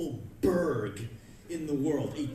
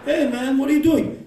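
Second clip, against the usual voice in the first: higher, loud indignant call; loud challenge; 8 semitones higher, 12 dB louder than usual. Retold or imitated. Customer confronting the comic, loud challenge. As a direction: shout the challenge.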